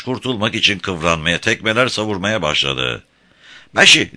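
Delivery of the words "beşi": high, loud call; excited shout. A narrator's reading. Small boy shouting for the king, high and loud. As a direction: shout out the name to get attention. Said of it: "diye bağırdı krala".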